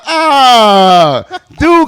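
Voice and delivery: loud high cry; startled cry. Retold or imitated. Facing a 357 in the alley, a loud startled cry. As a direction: cry out at the gun. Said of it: "I'm like".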